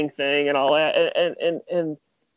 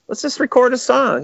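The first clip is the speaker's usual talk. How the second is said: pitched up high, breezy; imitating himself; 9 semitones higher than usual. Answering Ivan in the studio, mock high voice. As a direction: toss it off lightly in a high put-on voice. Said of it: "And I'd be like".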